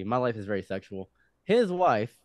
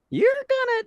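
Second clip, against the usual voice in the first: put-on very high strained old man voice; mock old man; 6 dB louder, much slower than usual. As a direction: squeeze it up high and loud like a crackly old man. Voicing old man Dylan.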